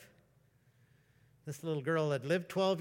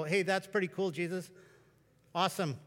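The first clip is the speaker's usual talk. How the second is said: mildly impressed and understated; reserved; 3 semitones higher than usual. Retold or imitated. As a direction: say it like a guarded churchgoer holding back. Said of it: "you would all be reserved".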